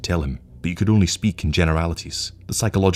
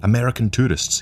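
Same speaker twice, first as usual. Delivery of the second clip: higher-pitched exclamation; crying out; 6 semitones higher than usual. A narrator's reading. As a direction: exclaim it to the table. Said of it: "he cried".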